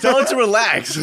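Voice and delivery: laughing, squirming plea; being tickled. Real, not acted. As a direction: blurt it out while being tickled.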